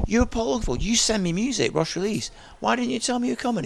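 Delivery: high, amazed disbelief; hammed-up astonishment; incredulous surprise. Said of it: "I went".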